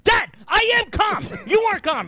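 high, strained argument with dad; hammed-up exasperated high shout; retold yelling. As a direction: act out yelling at your father. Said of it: "you're yelling".

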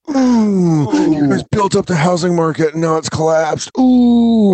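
high, gleeful taunting singsong; gloating mock ooh. Waiter gloating at stock market guys, high mocking ooh. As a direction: rub it in at the bankers with a mocking ooh. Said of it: "I'm like".

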